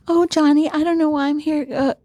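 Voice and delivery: quick, whimpery talk-show guest with nervous laugh; Teri Garr. Retold or imitated. Actress lost on the talk show, high flustered impression. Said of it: "Terry Garr".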